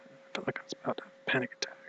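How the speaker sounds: fast, breathless whisper; whispering about a panic attack, fast; panicked whisper. Real, not acted. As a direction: rush it out under your breath. Describing panic after the news.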